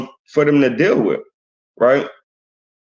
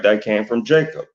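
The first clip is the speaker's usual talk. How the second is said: ordinary talk, nothing marked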